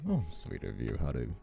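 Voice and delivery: usual speaking voice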